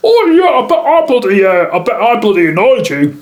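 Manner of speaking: very high, loud imitation; hammed-up impression, pitched far above normal; put-on character voice. Drunk guest owning up to the photographer, hammed-up voice. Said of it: "Sorry about the voices".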